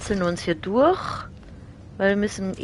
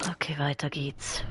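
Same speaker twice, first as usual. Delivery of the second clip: whispering; 2 semitones lower, 9 dB quieter than usual. Real, not acted. Quiet moment while sneaking on.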